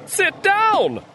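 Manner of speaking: loud high commanding call; lion tamer. A narrator's reading. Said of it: "said the lion tamer".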